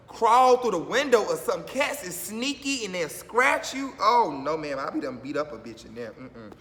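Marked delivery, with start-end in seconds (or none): none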